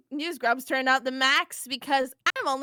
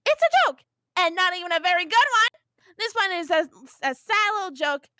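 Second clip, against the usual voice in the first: much higher, louder scornful jab; contemptuous outburst; 4 semitones higher, 4 dB louder than usual. Acted. Vriska denouncing the planet on air, loud scornful outburst. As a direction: spit out the scorn.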